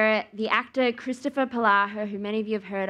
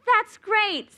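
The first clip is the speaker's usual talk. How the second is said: high, bright fake enthusiasm; forced cheer; 10 semitones higher, 3 dB louder than usual. To the course leader, a forced cheerful reply. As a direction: chirp it with a big smile. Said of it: "big smile".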